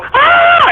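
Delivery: short, high-pitched mock scream; women's startled scream. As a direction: shriek like women caught in the bathroom. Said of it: "the women would go".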